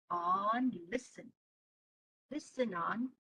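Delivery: low, very soft appeal; quiet, patient grandpa voice; calming grandpa. Acted. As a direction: gently hush an upset child. Grandpa calms the angry boy.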